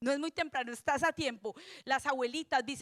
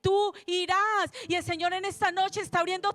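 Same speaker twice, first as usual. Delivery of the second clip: forceful preaching; 3 semitones higher, 4 dB louder than usual. Acted.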